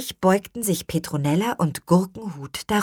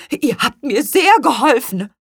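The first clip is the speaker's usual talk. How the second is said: excited thanks; 9 semitones higher, 7 dB louder than usual. Acted.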